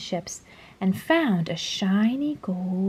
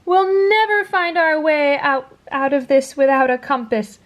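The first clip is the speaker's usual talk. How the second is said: high, louder panicky whine; frightened wail; 6 semitones higher, 4 dB louder than usual. A narrator's reading. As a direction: despair at being lost. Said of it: "cried the earthworm".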